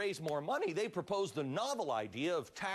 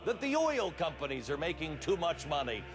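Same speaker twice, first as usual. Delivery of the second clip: grandiose party propagandist addressing comrades; hammed-up comrade orator reporting from the front; mock Soviet comrade; 4 semitones higher than usual. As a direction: deliver a mock state broadcast, rousing and theatrical.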